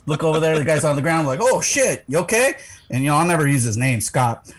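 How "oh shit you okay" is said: retold alarm. Coworker on ground.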